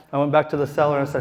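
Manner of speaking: ordinary talk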